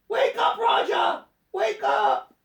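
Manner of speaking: very high, urgent cry; urgent elephant. A narrator's reading. Elephant fanning the fainted Rajah awake, urgent calling. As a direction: cry out to rouse someone.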